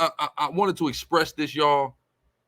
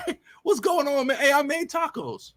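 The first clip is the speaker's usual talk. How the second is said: very high-pitched mock greeting from a dad; friend's dad; 13 semitones higher than usual. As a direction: imitate the dad calling out, voice way up high. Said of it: "Then her dad just walks out and goes".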